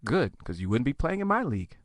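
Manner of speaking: low, quiet put-down; dry comeback. Acted. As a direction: drop it softly after their outburst.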